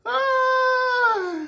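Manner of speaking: very high shrieking cry of laughter; high laughing shriek. Real, not acted. Shrieking with laughter at the memory. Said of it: "it was highly hilarious".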